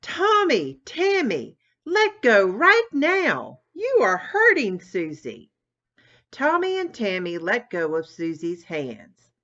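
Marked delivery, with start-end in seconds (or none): 0.0-5.5 stern mother. Acted. Mother stops the fight.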